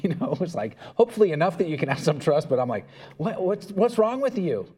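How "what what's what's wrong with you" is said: put-on higher, louder exasperated snap at the child; frustrated dad losing patience with his daughter; exasperated outburst. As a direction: vent at the scared kid. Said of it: "now I'm getting angry".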